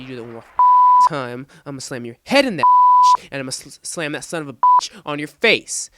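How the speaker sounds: loud, acted-out threat; retold angry threat. Narrator to the boy pinned on the locker, angry loud threat. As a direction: threaten him at near-shouting volume.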